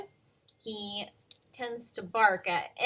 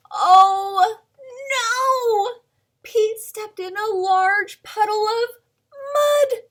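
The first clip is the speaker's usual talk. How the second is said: oh no; 9 semitones higher, 10 dB louder than usual. Acted. Dramatic storybook mishap.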